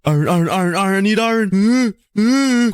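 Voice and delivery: high, eager oohs; giddy, high-pitched craving; excited. Real, not acted. Spots iron, gets excited.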